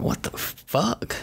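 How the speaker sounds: high, slow, breathy shock; disgusted disbelief. Retold or imitated. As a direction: recoil in horror. Reacting to vomit fetish.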